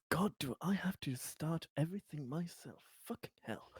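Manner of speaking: very soft, under-the-breath grumble; exasperated character aside. Acted. Conrad fed up at starting everything himself, muttered grumble. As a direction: mutter it, nearly whispering by the end. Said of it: "Conrad says".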